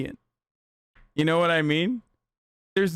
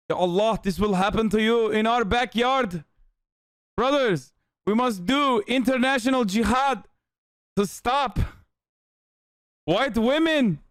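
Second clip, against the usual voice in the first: higher-pitched, hammed-up Middle Eastern accent; mock Taliban accent; 5 semitones higher than usual. Taliban recruiter warning his brothers, mock Middle Eastern accent. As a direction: preach in a comic put-on Middle Eastern accent. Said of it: "The Taliban shows this compilation".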